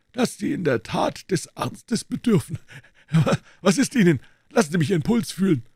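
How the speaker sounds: a hint of a composed doctor; the doctor explaining why he came in, lightly voiced. A narrator's reading. Doctor speaking in the story.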